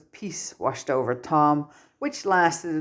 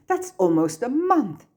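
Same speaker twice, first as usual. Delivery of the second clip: much higher, indignant protest; disbelief; 9 semitones higher, 3 dB louder than usual. Acted. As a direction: protest the punishment in shock. Tom protests detention in disbelief.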